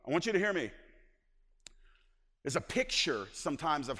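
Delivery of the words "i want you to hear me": normal talking voice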